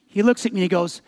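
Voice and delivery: normal talking voice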